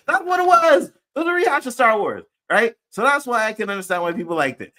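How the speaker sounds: fired-up fan yelling over a rehash; heated outburst. Real, not acted. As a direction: let it burst out in frustration. Ranting about a film rehash.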